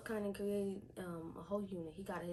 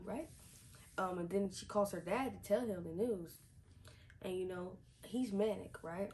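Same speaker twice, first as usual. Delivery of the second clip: normal talking voice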